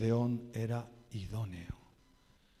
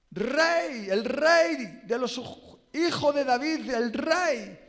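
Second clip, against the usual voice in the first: loud, high, impassioned preaching; fervent sermon climax; fiery preaching; 10 semitones higher, 11 dB louder than usual. Acted. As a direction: preach it out with full force, crying the crowd's words. Preaching at full force, crowd cries.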